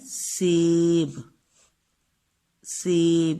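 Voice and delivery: سیب drawn out for the class; drawn-out model word. Shown as a demonstration. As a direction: say سیب slowly, holding the vowel. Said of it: "اس میں بڑی اے کی آواز ہے".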